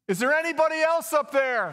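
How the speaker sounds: high, loud shout upward; acted high-pitched yell to the sky. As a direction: holler up for someone else, as a punchline.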